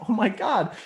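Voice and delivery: high-pitched acted disbelief; exasperated dismay. Refused traveler reacting to the consulate, high exasperated dismay.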